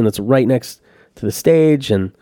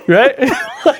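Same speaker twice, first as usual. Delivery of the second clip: high laughing right like; 12 semitones higher than usual. Real, not acted. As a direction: giggle the words out. Laughing at his own story.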